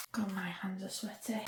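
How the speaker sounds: breathy whispered complaint; whispering. Real, not acted. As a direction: whisper it to yourself. Aside while sanding.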